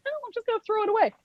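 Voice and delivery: very high, rapid reply; imitated puzzled protest; mock innocent insistence. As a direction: rattle it off in a small high voice. Acts out her puzzled insistence.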